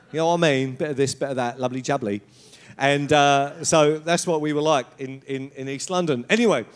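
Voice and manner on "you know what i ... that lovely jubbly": imitated Cockney patter; Jamie Oliver. Showing how all the Cockney relatives talked, Cockney accent. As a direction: put on a chatty East London accent. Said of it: "they all talked like Jamie Oliver".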